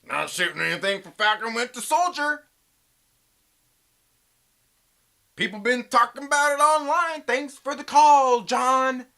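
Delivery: mock broadcaster thanking a caller; mock news voice. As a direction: ham up a TV host signing off. Mock phone call parody.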